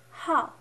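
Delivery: "how" loud, falling sharply from high; fourth tone. Shown as a demonstration. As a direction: drop "how" firmly. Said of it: "pronunciation tones".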